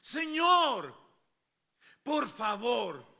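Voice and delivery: high, loud, anguished plea; afflicted cry. Acted. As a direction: call out in distress, stretching the words. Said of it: "estaban un poco afligidos y tristes". (clamor afligido y triste)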